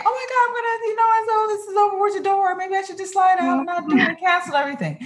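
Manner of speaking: high, fast, panicky self-talk; panicked inner voice. Retold or imitated. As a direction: spiral into stage-fright panic. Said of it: "Or before I'd say".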